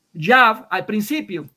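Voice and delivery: demonstrating how "Yav" should sound; pronunciation demo. Shown as a demonstration. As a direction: spell out "Yav" for the opponent, slow and clear. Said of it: "se debe de pronunciar".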